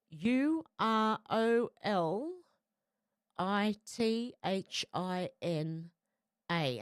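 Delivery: 逐个字母慢慢拼读; letters spelled one by one, slowly; spelling out slowly. Shown as a demonstration. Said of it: "write this down if you can".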